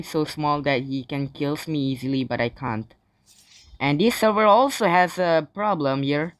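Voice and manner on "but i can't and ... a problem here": ordinary talk, nothing marked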